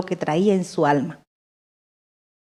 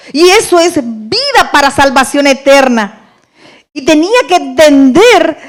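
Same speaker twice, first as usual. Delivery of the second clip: higher and louder, building preacher's fervor; fervent preaching; 5 semitones higher, 5 dB louder than usual. Real, not acted. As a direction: preach it out with rising conviction. Testimony rising into preaching.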